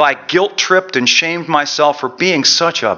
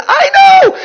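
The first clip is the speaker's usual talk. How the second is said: shrieked reply, extremely high and loud; hooting with laughter; 9 dB louder than usual. Acted. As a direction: squeal it through acted hysterics. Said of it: "gasped and hooted".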